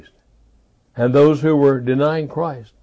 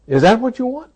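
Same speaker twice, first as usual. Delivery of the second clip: ordinary talk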